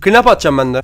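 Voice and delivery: ordinary talk, nothing marked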